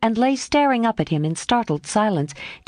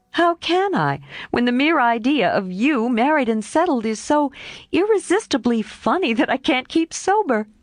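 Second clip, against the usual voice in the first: higher, barely keeping a straight face; amused Jo; 5 semitones higher than usual. A narrator's reading. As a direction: she finds him too funny.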